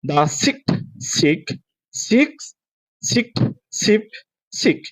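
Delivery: one word at a time, long pauses; slow practice. Shown as a demonstration. Teacher models the practice for students, slowly. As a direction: isolate each word of the tongue twister. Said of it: "let's go for the practice".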